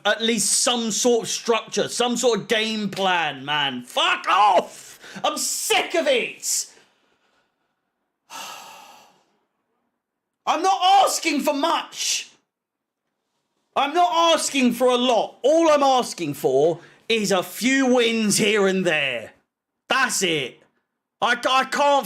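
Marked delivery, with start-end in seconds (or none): 3.8-6.7 high-pitched, fed-up cry; real exasperated outburst. Real, not acted. Fan sick of losing, exasperated high outburst. Fan loses patience with the team. 10.4-18.4 high, frustrated protest; exasperated pleading. Real, not acted. Fan begging for a few wins, exasperated plea.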